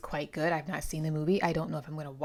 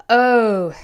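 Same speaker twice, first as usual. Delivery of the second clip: loud, high exclamation; big oh; 5 semitones higher, 14 dB louder than usual. Real, not acted. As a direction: blurt it out loud. Big exclamation before discussion.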